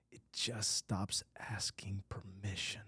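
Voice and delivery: a hushed, breathy finish to the thought; whisper. Retold or imitated. Jung confides to the troubled guy in a whisper. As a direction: drop to a whisper to close the line. Jung's line ends in a whisper.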